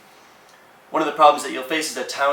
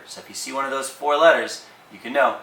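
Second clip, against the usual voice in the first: normal talking voice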